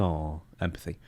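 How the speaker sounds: mock sympathy, dropped very soft and low; a quiet, snide little aw of pity; sarcastic aw. Real, not acted. Host mocking the empathy claim, sarcastic and hushed.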